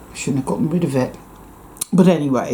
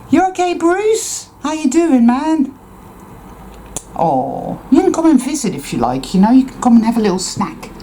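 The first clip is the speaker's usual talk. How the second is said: much higher, fast cooing pet voice; pet talk; 10 semitones higher than usual. Real, not acted. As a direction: coo to the cat to invite him. Talking to the neighbor's cat.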